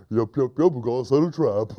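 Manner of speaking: goofy yes-man echo; silly hunter. Acted. Silly hunter copying the trap plan, goofy eager voice. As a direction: parrot it eagerly and dimly. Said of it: "He repeats everything his friend says".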